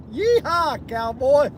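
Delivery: high cowboy yeehaw; cowboy whoop. Pretend. Driver whooping like a cowboy, high and playful.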